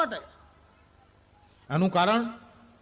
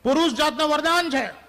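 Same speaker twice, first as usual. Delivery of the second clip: high, louder punchline; 8 semitones higher, 6 dB louder than usual. Real, not acted. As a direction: belt the line out to the audience. Punchline to audience. (હોલ સામે મોટેથી, ઊંચા અવાજે કહેલી પંચલાઇન)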